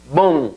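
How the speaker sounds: BOM with nasal o, m almost lost; nasalized o. Shown as a demonstration. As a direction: nasalize the o in BOM, barely closing the m. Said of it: "o se nasaliza completamente y la M es casi que se pierde".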